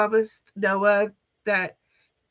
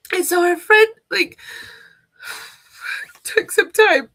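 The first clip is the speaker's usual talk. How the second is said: high, loud tearful voice; 8 semitones higher, 6 dB louder than usual. Real, not acted. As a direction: push through the tears. Crying while telling.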